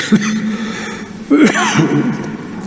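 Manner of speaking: drawn-out throat-rattling cough; real coughing. Real, not acted. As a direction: cough through an interrupted speech. Coughing interrupts the speech.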